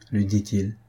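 usual speaking voice

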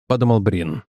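ordinary delivery

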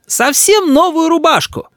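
very high grandmother's outrage; shrieked scolding. A narrator's reading. Grandmother blames mother for the iron, outraged cry. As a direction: scold away at a high pitch. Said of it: "кричит бабушка".

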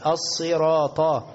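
slow "الصراط" with si and heavy final ta; correct heavy ta. Shown as a demonstration. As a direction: stretch "الصراط" with emphatic ط. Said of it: "الفك لأسفل". («الصراط» بطاء مفخمة)

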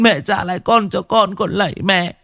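plead, running out of strength; a mother begging on her knees, fading; fading tearful begging. Acted. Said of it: "mếu máo".

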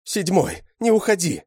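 high, loud, pleading call; woman screaming. A narrator's reading. Woman calls after fleeing Seventh, pleading scream. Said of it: "закричала женщина".

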